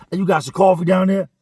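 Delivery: loud, high pushy call; yelling down. Retold or imitated. Trap hollering at little dudes for coffee, loud. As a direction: demand it down the tier. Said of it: "starts yelling around".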